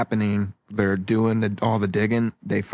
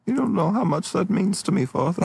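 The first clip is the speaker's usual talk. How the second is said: Winnie the Pooh; 7 semitones higher than usual. Acted. Son moved by his father's pride, high Pooh-like voice. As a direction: pitch it up like a cartoon bear. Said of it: "I sound like Winnie the Pooh".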